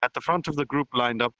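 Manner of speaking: ordinary delivery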